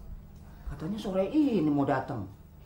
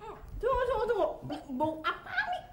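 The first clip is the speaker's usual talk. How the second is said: sniffing suspicious; 3 dB quieter than usual. Acted. Sniffing out a bad smell.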